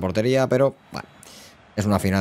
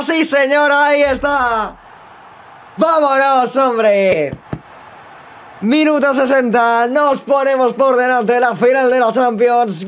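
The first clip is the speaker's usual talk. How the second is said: very high, loud, drawn-out goal call; sustained high shout, stretching the words; ecstatic goal commentary; 6 dB louder, slower than usual. Real, not acted. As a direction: bellow it like a football commentator after a goal. Live goal call in football game.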